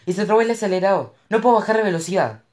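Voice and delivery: high, loud and fast panic; panicked. A narrator's reading. Cash tells Mo the throttle is stuck, panicking. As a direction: rush it out in alarm.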